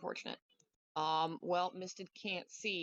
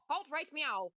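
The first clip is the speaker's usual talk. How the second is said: high playful halt; punny command; 9 semitones higher than usual. Pretend. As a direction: sing out the cat pun. Pun on halt right now.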